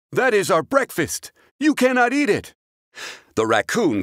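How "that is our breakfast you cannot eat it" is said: high, louder scolding pup; dog voice. Acted.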